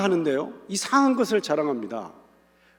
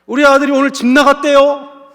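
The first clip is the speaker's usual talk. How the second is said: joyful shout of praise; 8 semitones higher, 10 dB louder than usual. Pretend. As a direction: cry out hallelujah as if bad news were good. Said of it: "오늘 식으로 따진다면".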